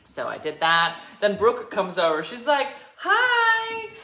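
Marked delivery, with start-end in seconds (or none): 3.0-3.9 very high, loud squealed hello; gushing greeting. Retold or imitated. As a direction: imitate a friend's over-excited greeting. Friend's greeting acted out, retold.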